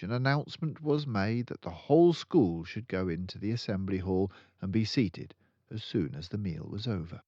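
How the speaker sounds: ordinary delivery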